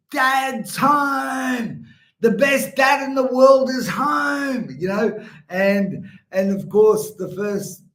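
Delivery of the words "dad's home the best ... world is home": high, drawn-out triumphant holler; yelling out. Retold or imitated. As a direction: call it out far across the paddock, long and loud. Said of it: "hang my head out the window yelling out".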